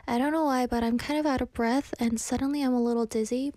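usual speaking voice

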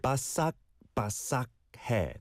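바삭바삭해 with a crisp k stop in each 삭; final k sounds clear. Shown as a demonstration. Teacher shows a listener the k in 바삭바삭해, clear and careful. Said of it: "There's a 기역 받침 bottom consonant".

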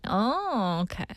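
ordinary talk

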